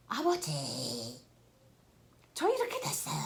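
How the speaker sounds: high, tearful plea to Father; crying out. Pretend. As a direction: cry out to God like a hurting child. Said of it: "하고 부르짖어 보세요".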